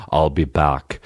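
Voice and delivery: put-on low lightly done Austrian Terminator accent; a hint of Arnold's Austrian drawl; Schwarzenegger accent. Mocking Terminator line, light Austrian accent. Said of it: "redo the line".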